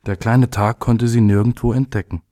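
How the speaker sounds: ordinary delivery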